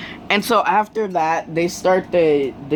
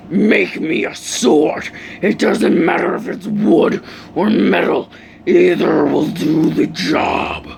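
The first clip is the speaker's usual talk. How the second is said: demon mode. Acted. Enraged hero demanding a sword from classmate, demonic fury. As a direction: growl the order like an enraged demon. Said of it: "He goes full on demon mode".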